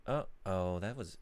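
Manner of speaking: usual speaking voice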